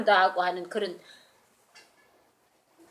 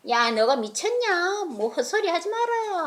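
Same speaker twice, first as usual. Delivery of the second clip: scornful; 8 semitones higher, slower than usual. Acted. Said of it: "로데가 그러니까".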